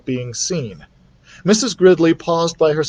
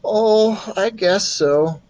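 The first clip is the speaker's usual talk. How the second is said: unenthusiastic; 8 semitones higher, much slower than usual. A narrator's reading. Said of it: "no enthusiasm in Keith's voice".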